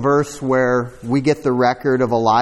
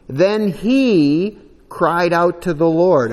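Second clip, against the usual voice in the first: "Then he cried out to the Lord" drawn out slowly, each word pressed; slow, emphatic scripture line; emphasis on the phrase; 4 semitones higher, 4 dB louder, slower than usual. Shown as a demonstration. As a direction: lean hard on "cried out to the Lord". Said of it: "I'm putting the emphasis on it".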